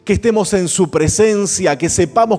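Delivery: high, loud fervent sermon delivery; full-force preaching. Acted. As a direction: preach it hard, driving each clause home.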